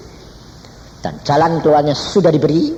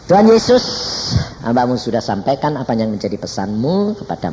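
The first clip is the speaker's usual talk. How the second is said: prayer; 4 semitones lower than usual. Acted. Preacher leading the congregation in closing prayer. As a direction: pray over the congregation.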